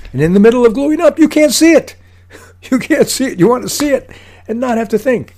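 high, loud, frantic outburst with a laugh; comic panic. Acted. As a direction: act out mid-job panic, voice shooting way up. Comic panic in mid glue-up.

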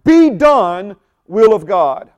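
emphatic decree, loud on BE DONE; foot-down command. Shown as a demonstration. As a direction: put your foot down on "Be done". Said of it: "putting our foot down".

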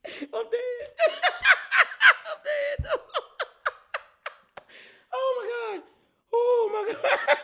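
high, squealing laughter through the words; can barely talk for laughing; laughing hard. Real, not acted. Cracking up at the stat.

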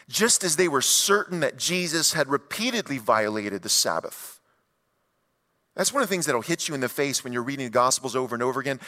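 ordinary talk, nothing marked

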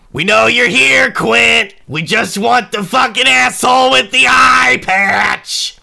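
high, full-volume yelled threat; demanding shout. Acted. Gang outside demands the man from Quint, angry yell. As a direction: holler up at someone hiding inside.

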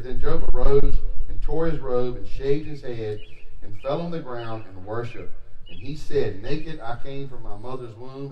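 measured, solemn Bible reading, starting loud; scripture reading. Acted. Pastor reading Job to the congregation, solemn reading. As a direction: read the verses with weight. Reads scripture aloud to church.

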